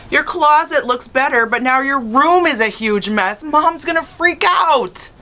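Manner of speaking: high, slow mocking brother voice; teasing older brother. A narrator's reading. Big brother taunting Charlie about the mess, high and slow. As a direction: stretch out the warning, teasing.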